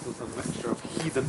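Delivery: hushed, near-whispered explaining; soft whispered commentary in a quiet church. Real, not acted.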